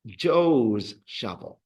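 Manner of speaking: "Joe's shovel" said slowly with stress on "Joe's"; slow model phrase. Shown as a demonstration. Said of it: "We would go with".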